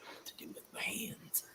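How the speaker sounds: hushed, voiceless echo of a joke; barely audible playful whisper. Pretend. Echoing the co-host's joking line.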